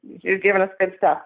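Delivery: normal talking voice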